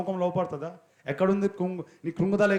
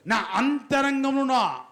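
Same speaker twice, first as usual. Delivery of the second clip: higher, slow fervent prayer line; prayer recitation; 8 semitones higher than usual. Shown as a demonstration. Preacher showing the congregation how to pray, higher and slow. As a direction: pray it out slowly, lifting each phrase. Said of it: "చెప్పాలి ఒకసారి".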